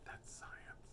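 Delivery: hushed, voiceless aside; whispered aside. Real, not acted. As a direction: whisper it under your breath. Dry aside after joke.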